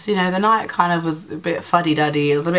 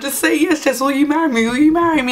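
high-pitched, repeated mock begging; insistent, excited retold plea; urgent pleading; 9 semitones higher than usual. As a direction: imitate a frantic proposal, pressing for an answer. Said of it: "He was, like, shaking me".

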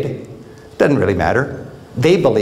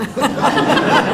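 a high, airy laugh breaking out; high giggle of self-amusement; high burst of laughter; 12 semitones higher than usual. Real, not acted. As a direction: laugh at yourself, high and bright. Laughing at own admission.

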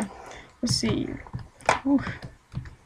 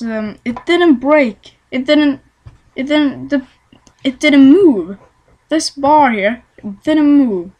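louder, hammering didn't; insistent complaint; 3 semitones higher, 6 dB louder than usual. Real, not acted. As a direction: pound each didn't in frustration. Ranting about broken pickaxe.

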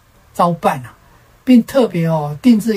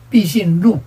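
plain reading voice

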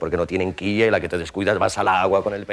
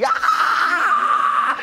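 high laughing cry of pain; mock pained yelp. Rival bitten by piranhas, hammed-up laughing yelp. Piranhas bite his hand.

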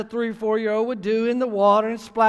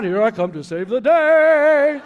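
louder, laughing hammed-up hero cry; playful superhero theme line; mock superhero; 5 dB louder than usual. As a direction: belt it like a cartoon rescuer swooping in. No, I didn't do that.

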